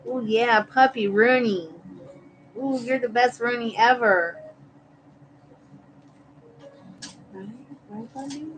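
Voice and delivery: high cooing pet voice. Real, not acted. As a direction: gush over the dog. Talking to her puppy.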